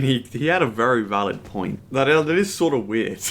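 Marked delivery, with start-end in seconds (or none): none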